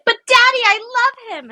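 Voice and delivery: high, loud, hammed-up plea to her father; Ariel pleading. Daughter pleading with her father King Triton, high hammed-up plea. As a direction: cry it like a lovesick mermaid princess. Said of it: "Where she's like".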